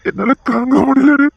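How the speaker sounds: anguished. Acted. Father grieving for daughter.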